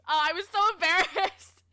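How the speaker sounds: high, loud, laugh-broken confession; embarrassed laughing. Real, not acted. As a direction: admit it loudly while cracking up.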